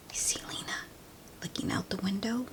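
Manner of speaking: quick hushed whisper; fast soft whisper. Real, not acted.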